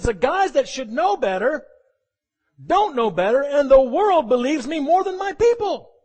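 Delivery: high, loud and slow lament; Jesus marveling. Acted. Preacher voicing Jesus praising the centurion's faith, high and slow. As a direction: sound amazed and grieved at once. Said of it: "He says".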